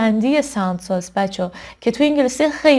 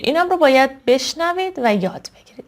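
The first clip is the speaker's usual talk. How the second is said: usual speaking voice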